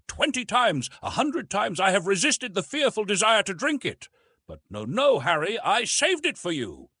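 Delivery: put-on high, intense voice of the old professor; old uncle, pleading. A narrator's reading. Old uncle urging his nephew to drink, intense. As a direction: press the last drop on him urgently. Uncle's long emotional speech.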